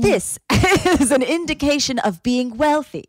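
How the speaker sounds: high, emphatic lecturing quote; mock explainer voice. Mocking a reality show explanation, pompous. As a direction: declare it like a pompous narrator. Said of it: "it's like".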